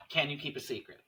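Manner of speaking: usual speaking voice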